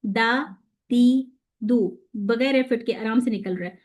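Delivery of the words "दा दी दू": दा दी दू stretched out very slowly; drilled syllables. Shown as a demonstration. Teacher demonstrating easy open syllables to students. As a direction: draw out each of दा, दी, दू. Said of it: "हरकत के साथ देखें तो सिंपल".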